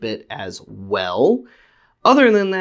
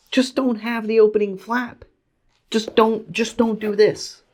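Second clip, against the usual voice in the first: much higher, pleading put-on voice; mock squeaky plea; 12 semitones higher than usual. A toy collector pleading with Hasbro in a high mock voice.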